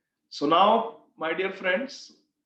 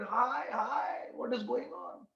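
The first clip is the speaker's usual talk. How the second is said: mock panic; 6 semitones higher, 5 dB quieter, slower than usual. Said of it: "getting very scared, panicky, and they said".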